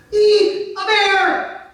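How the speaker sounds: high, loud squeal of fright; hammed-up scream of alarm; mock terrified shriek. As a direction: shriek like a scared maiden in a tale. Maiden sees bear and flees.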